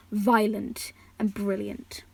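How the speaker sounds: ordinary delivery, nothing marked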